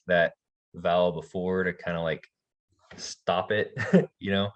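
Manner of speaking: ordinary delivery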